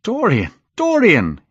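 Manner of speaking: high, falling calls of a name; alarmed cry. A narrator's reading. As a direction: call out to him in alarm. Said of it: "he cried".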